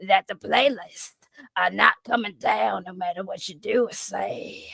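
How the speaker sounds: Glizzy Goblin voice. Acted. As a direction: growl out taunts at Rican like a crude goblin. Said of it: "I'm gonna do it in the voice now".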